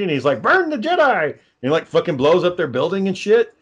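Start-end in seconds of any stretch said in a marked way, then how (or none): 0.4-1.2 much higher, cackling mock Yoda cry; Yoda squeal. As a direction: shriek it like a crazed little Yoda. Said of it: "he starts laughing uncontrollably".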